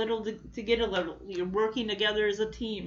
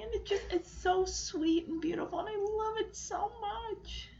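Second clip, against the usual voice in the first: higher and softer, teary; moved to tears; 9 semitones higher, 7 dB quieter than usual. Real, not acted. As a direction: gush while fighting tears. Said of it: "I'm gonna cry".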